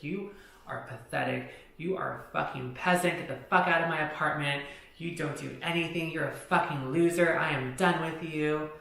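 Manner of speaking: building, emphatic telling-off; retold angry tirade. Woman throwing friend out of her apartment, retold angry tirade.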